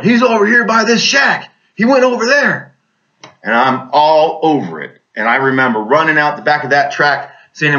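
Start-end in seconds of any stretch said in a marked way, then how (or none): 0.0-2.7 high-pitched reenacted holler; urgent mock yell, pitched way up; retold screaming. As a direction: call out urgently, imitating a comrade's scream. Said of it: "Ford's screaming and hollering".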